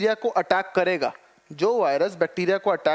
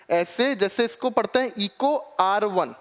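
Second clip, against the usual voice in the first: ordinary talk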